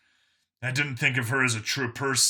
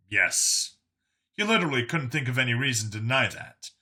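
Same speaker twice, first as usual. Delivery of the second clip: usual speaking voice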